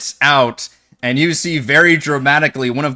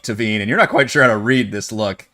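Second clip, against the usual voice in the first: ordinary talk, nothing marked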